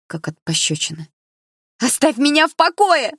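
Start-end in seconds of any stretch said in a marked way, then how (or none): 1.8-3.1 high, loud furious shriek at her ex; furious scream. A narrator's reading. Blonde screams at her pursuing ex, furious high shriek. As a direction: scream it at him in a fury. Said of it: "закричала она яростно".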